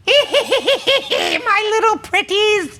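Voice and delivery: high, slow cackling witch voice; Wicked Witch cackle. Acted. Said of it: "your best Wicked Witch cackle".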